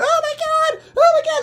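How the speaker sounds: high, loud panic; hammed-up screaming; horrified shriek. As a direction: shriek repeatedly in horror. Victims in horror.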